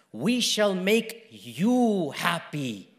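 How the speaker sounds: slow, drawn-out, low reassurance; hushed, lingering comfort; tender promise. Retold or imitated. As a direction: promise it gently, word by word. Same promise, tender then proclaimed.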